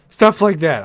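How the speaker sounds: breathless after a long rant; out of breath. Real, not acted. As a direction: gasp it out, winded. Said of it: "I'm out of breath".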